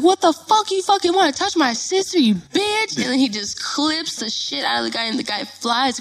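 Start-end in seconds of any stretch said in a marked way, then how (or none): 0.0-2.9 high, loud-starting angry challenge; furious brother. Retold or imitated. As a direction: re-enact a brother confronting the culprit. Acts furious brother attacking.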